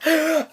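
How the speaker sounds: quick giggle; brief burst of laughter; short laugh. Real, not acted. Host laughs mid outro.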